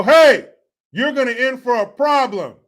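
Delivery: loud, high-pitched warning shout; mock threat. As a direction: bellow it to scatter a crowd. Demonstrates his intimidating catchphrase.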